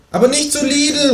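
high kangaroo voice calling out; kangaroo calling after. A narrator's reading. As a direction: call it after him as the kangaroo. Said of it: "ruft mir das Känguru hinterher". (hohes Känguru ruft hinterher)

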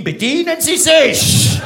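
high, loud, drawn-out command; military bark. Acted. Instructor offers himself to urologist, bellowed command. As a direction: bellow it slowly like a parade order.

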